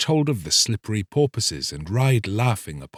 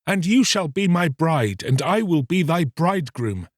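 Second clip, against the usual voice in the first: raised, eager promise; joyful vow; 6 semitones higher than usual. Acted. Fisherman vows to the mermaid, raised and eager. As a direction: promise her everything.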